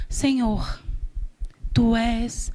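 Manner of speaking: soft, low murmured prayer; low, near-whispered address to God; hushed mock prayer. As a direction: pray under your breath, as if imitating piety. Mimics a hushed formal prayer.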